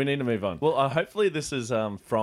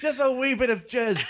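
mock Scottish friend; 12 semitones higher, 3 dB louder than usual. Acted skit Scottish quip.

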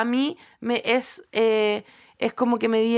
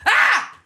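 sudden high loud scream; high loud startled yell; retold scream; 10 semitones higher, 6 dB louder than usual. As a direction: yelp as if startled awake. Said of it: "escuché un grito, así".

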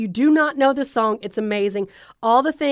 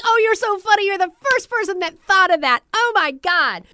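mock gushing; 8 semitones higher, 3 dB louder than usual. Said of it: "It's like".

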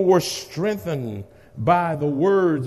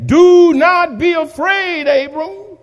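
preaching at full force; 11 semitones higher, 8 dB louder than usual. Acted. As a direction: call it out over the congregation. Preached quote of the Lord.

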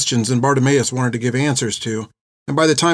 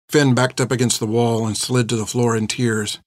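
ordinary delivery

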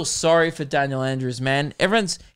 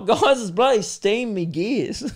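laughing mix-up; 4 semitones higher than usual. Retold or imitated. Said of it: "I was like".

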